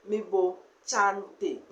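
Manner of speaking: Mebo chante slowly, bringing out the N in chante; slow demo of Mebo chante with the inserted N; nasal N sound. Shown as a demonstration. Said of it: "Guan have this N sound".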